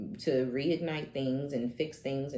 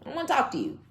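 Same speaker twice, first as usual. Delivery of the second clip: laughing dismissal; 11 semitones higher, 3 dB louder, much faster than usual. Real, not acted.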